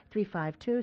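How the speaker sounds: normal talking voice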